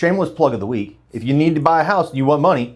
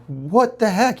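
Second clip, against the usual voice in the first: higher-pitched mock "what on earth"; retold bafflement; 7 semitones higher, much slower than usual. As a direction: act out being baffled. Retelling his baffled reaction.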